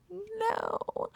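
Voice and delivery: high squeaked falsetto no; squeaky no. Real, not acted. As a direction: squeak it out in mock disbelief. Squeaky reaction after laughing.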